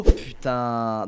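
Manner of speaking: ordinary delivery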